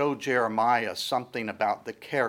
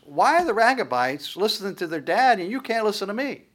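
higher, pleading why; exasperated rebuke; 7 semitones higher than usual. Acted. God rebuking Judah for not listening, exasperated. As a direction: press the contrast home.

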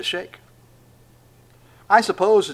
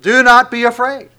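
emphatic angel command; 8 semitones higher, 12 dB louder than usual. Pretend.

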